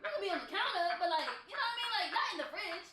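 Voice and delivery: high-pitched, laughing objection; incredulous protest. Acted. Telling a guy to back up from the fridge, incredulous protest. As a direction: plead in mock disbelief, voice way up.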